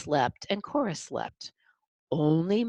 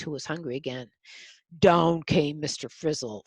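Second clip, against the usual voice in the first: plain reading voice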